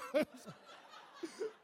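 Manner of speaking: high stifled giggle; laugh. Real, not acted. Recalling the caning scene, a high giggle. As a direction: let a giggle slip out.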